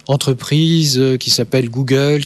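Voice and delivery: ordinary talk, nothing marked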